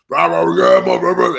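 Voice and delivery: loud garbled mock roar; imitated screaming. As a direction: roar gibberish like a hyped-up player. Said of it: "He comes back screaming".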